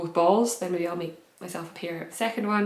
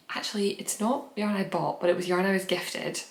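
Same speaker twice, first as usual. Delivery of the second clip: ordinary talk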